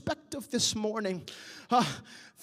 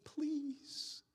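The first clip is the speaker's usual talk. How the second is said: softer, fading into a whisper; whispered prayer; 14 dB quieter, slower than usual. Retold or imitated. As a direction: pray it quietly into the night. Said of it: "those nights where you've cried".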